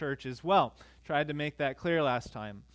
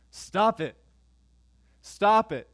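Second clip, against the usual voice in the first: "Stop it" said louder the second time, firm falling tune; preacher voicing Paul's firm rebuke; emphatic command; 3 semitones higher, 6 dB louder, slower than usual. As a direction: hit "Stop" hard, like a warning. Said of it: "an emphasis there".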